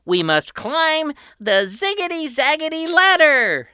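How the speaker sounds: put-on high, loud tour-guide kid; proud boy host. A narrator's reading. Patrick welcoming his family underground, high and proud. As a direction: announce it like a grand showman. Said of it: "Patrick explained".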